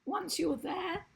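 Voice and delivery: strained, failing voice; losing voice. Real, not acted. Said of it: "oh, I'm losing my voice".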